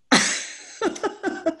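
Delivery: high, breaking burst of laughter; helpless high-pitched laugh. Real, not acted. Reacting to her own foot-washing story.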